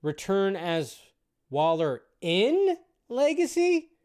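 slow, with IN and LEGACY pushed high; drawn-out "in", squeaky-high questioning finish; pointed questioning echo. Retold or imitated. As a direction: mimic a puzzled question, pitch climbing sharply on "in". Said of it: "why did you say it like that".

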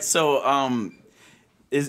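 ordinary talk

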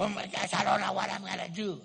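loud, high, hammed-up panic; panicked outburst. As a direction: wail it at full volume, overwhelmed. Acts out an upset, amped-up person.

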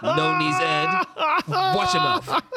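mock tiny voice. Mocking the midget vocal cords jab in a tiny voice. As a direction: squeak it out like a little person. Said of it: "we should switch voices".

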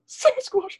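high yelp of the name; calling out. Pretend. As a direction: yelp it like you just spotted him.

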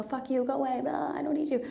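high-pitched, quick mock-angry dismissal. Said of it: "this was very angry".

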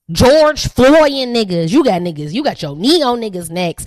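usual speaking voice

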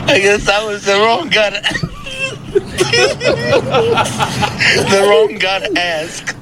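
laughing helplessly mid-sentence. Real, not acted. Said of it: "I don't know why I'm laughing".

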